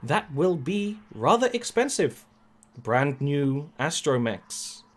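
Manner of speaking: measured, formal droid voice; protocol droid. Acted. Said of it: "the protocol droid blinks up and says".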